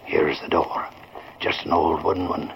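low hushed whisper, barely voiced. Sergeant whispering orders to his men before the raid, hushed. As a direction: keep it under your breath so the crooks inside won't hear. Police sneaking up to door.

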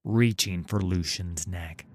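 plain reading voice

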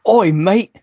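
high, loud mock English accent; English impersonation. Shown as a demonstration. Co-host attempts an English impersonation on request, mock English accent. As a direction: put on a hammy English accent. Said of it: "best English impersonation".

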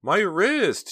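sharp, high cry of pain. A narrator's reading. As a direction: gasp out the words in pain. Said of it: "gave a little gasp of pain".

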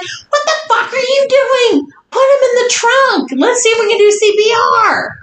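high-pitched retold outburst; urgent, high orders at the niece; frantic scolding. Retelling her alarmed scolding of niece.

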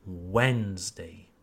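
slow, low, soft Wednesday as "WENZ-day"; Wednesday stressed up front, falling; stress on first syllable. Shown as a demonstration. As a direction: draw out the start of Wednesday, let "day" drop. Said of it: "the first syllable".

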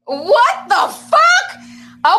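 high, very slow laughing what the fuck; laughing disbelief. Real, not acted. Vlogger reacting to her own face on screen, laughing in disbelief.

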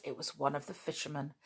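usual speaking voice

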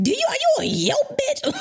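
high-pitched, indignant retold challenge; going off. Retelling challenge to client over Yelp threat, going off. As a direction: squeal the accusation in mock outrage. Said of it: "So I went the fuck off".

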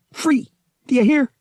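high, loud cry of freedom; defiant shout. Acted. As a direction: hurl it back at the invading mind. Han shouting down the invasion.

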